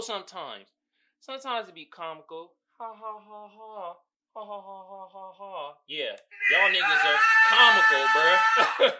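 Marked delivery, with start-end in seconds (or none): 2.8-5.7 mock laugh. Mocking the comical audience. 5.9-8.9 loud shout at the comment section. Real, not acted. Shouting at the audience.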